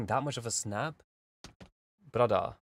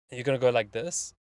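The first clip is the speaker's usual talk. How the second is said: ordinary talk, nothing marked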